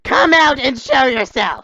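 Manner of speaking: loud, high, slow demand; angry shout. Acted. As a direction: challenge the hidden foe at a shout. Confronting the hidden train.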